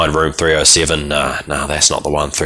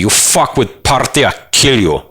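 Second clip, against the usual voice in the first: put-on threatening Eastern European accent; 8 semitones higher than usual. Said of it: "the party prince reminds you".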